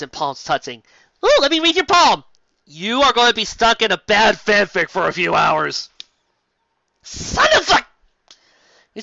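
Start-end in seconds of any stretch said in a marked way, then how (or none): 1.2-5.9 high, loud sing-song palm reader; hammed-up fortune teller. 7.0-7.9 very high, loud cut-off curse; mock outraged yelp. Outraged at the prophecy.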